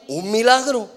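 "un milagro" proclaimed high and loud; rousing, emphatic declaration; emphatic affirmation. Real, not acted. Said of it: "eso es lo que necesitamos".

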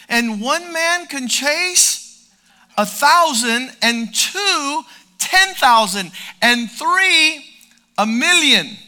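loud, slow building proclamation; fiery preaching. Acted.

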